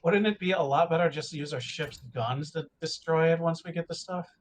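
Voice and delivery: ordinary talk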